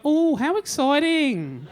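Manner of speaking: loud, slow, mock-excited chirp; the bogan receptionist greets his cancer check with loud glee; cheery receptionist. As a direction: play the bogan receptionist gushing.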